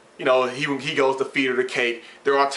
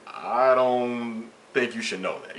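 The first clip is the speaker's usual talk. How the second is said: slow careful refusal; slower than usual. Retold or imitated. Refusing girlfriend's condom size question.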